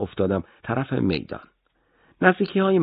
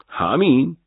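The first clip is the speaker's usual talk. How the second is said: همین? pushed higher and louder with a rising question; incredulous; 7 semitones higher, 5 dB louder, much slower than usual. Acted. Officer echoing the major's answer in disbelief. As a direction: throw همین back in disbelief.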